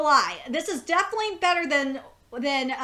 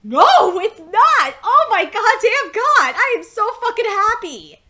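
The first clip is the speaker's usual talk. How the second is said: much louder and higher, triumphant relief; ecstatic shout; 7 semitones higher, 11 dB louder than usual. Real, not acted. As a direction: shout it out in pure joy. Relieved excited outburst.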